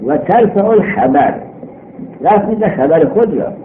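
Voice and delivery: ordinary talk, nothing marked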